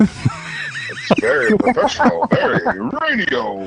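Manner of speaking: high, slow, drawn-out radio parody; podcasting voice. Pretend. Friend teasing hosts about podcasting voices, mock radio voice. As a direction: stretch it out like a hammy broadcaster. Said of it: "there's an actual podcasting voice".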